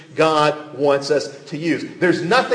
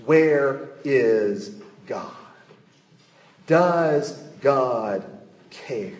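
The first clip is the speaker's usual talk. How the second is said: slow, hushed, lower questioning; 4 semitones lower, much slower than usual. Acted. Sufferer in pain asking where God is, slow and hushed. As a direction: let the doubt sink away.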